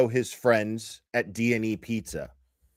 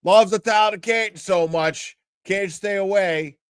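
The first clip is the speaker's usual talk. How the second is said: louder, higher mocking taunt; mock yell; 6 semitones higher, 5 dB louder than usual. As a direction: jeer it at him across the lot. Mocking quote of Turtle Boy.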